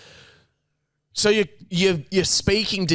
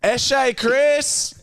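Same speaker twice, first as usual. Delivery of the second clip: mock yell; 8 semitones higher than usual. Friend mimicking a fan yelling to the singer, mock yell. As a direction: belt it out like a fan at a concert. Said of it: "what does Pat yell out".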